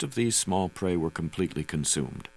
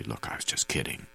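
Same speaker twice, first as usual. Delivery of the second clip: low, hushed near-whisper; gently lowered voice, barely above a whisper; very soft; 7 semitones lower, 7 dB quieter than usual. A narrator's reading. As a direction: say it very softly, pitched low. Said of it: "I said very softly".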